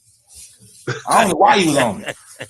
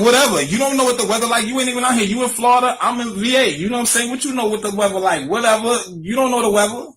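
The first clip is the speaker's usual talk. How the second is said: much higher, sassy brush-off; dismissive whatever; 7 semitones higher than usual. Retold or imitated. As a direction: toss it off with high-pitched sass. Dismissing friend, high pitched.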